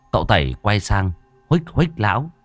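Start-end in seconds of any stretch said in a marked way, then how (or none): none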